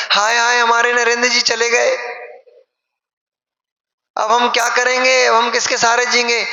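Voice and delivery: high-pitched mock lament, hammed-up; mock wailing wife. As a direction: imitate a wife wailing over her husband, half in jest. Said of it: "अब घर में देवी जी रोने लग जाए". (नकली रोना-धोना, ऊँची आवाज़ में विलाप)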